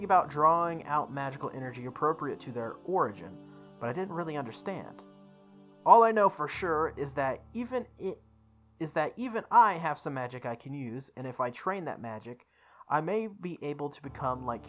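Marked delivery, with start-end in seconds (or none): none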